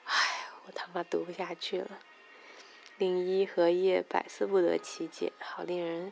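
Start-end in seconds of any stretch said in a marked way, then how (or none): 0.1-2.0 sigh, then whisper the complaint; an embarrassed whisper to the listeners; whispered aside. Real, not acted. Reader giving up on the text, whispering.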